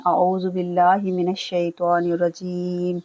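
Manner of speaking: slow, measured Quranic chanting; slow tilawat opening; Quran recitation. Shown as a demonstration. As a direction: intone it as formal recitation. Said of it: "चलिए start करते हैं".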